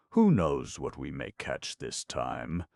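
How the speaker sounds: ordinary delivery, nothing marked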